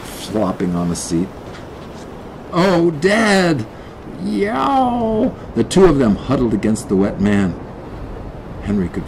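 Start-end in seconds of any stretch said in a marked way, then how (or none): 2.5-3.6 high, emotional cry; a touch of tearful relief; relieved kid. Acted. Kid greets rescued Dad, relieved. 4.2-5.3 high meowed answer; cat's yowl. Acted. Cat answers the kid at the tiller, yowling.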